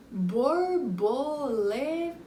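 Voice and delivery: "borboleta" slowly drawn out, beat by beat; long, wavy "borboleta" for counting; stretched-out syllables. Shown as a demonstration. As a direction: linger on every syllable of "borboleta". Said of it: "Quatro sílabas".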